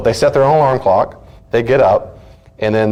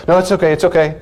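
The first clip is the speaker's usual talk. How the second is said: mock child reassurance; 9 semitones higher, faster than usual. Child forgiving parent after outburst.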